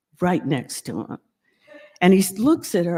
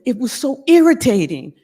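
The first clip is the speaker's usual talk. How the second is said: irritated; 3 semitones higher, 7 dB louder, faster than usual. Retold or imitated. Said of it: "And he said".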